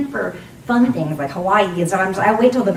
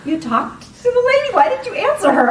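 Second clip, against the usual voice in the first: high, loud mock disbelief; imitated incredulous friend; 14 semitones higher, 4 dB louder than usual. Friend questioning her about the stall chat, mock-incredulous. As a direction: question it as if utterly baffled.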